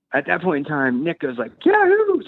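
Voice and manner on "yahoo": a much higher, loud wild yell; yahoo scream. Retold or imitated. Driver screaming before he punches the windshield, a wild high yell. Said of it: "screams and punches the windshield".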